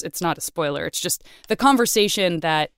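ordinary talk

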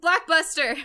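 high, loud exclamation; big mock-dramatic topic reveal; announcing. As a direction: shout it out triumphantly. Calling out the topic in answer.

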